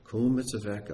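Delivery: normal talking voice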